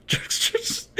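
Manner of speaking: softly whispered jerks; teasing whisper. Pretend. Streamer teasing chat about the word, mock whisper. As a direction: hiss it as a tease.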